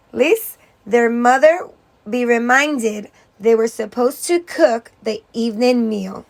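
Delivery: ordinary delivery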